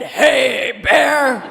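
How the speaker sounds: loud, commanding shout at the bear; a firm, loud warning aimed at a charging grizzly; deep firm voice. Retold or imitated. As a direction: stand your ground and call it out firmly. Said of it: "in a deep, firm voice".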